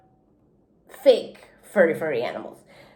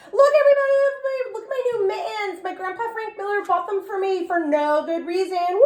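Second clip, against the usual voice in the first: excited shout; 9 semitones higher, faster than usual. A narrator's reading. Junie B shows off mittens.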